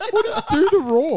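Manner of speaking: high, laughing what is it; giggling disbelief; laughing question. Real, not acted. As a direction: ask while still laughing.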